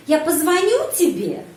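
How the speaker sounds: позвоню pushed up in pitch, then falling; question stress on позвоню. Shown as a demonstration. Teacher showing how to turn a statement into a question, stress on позвоню. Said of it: "penekanan pada kata kerja позвоню".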